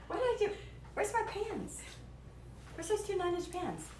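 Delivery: high, soft fretting to herself; high searching mumble. Acted. Cook searching the kitchen for pans, softly high and fretful. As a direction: mutter high while you hunt around. Hunting for her cake pans.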